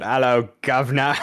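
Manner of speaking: a cheery bad Cockney hello; Cockney accent. Shown as a demonstration. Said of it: "I try to do the opposite".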